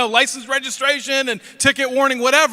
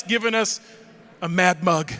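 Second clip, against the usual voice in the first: normal talking voice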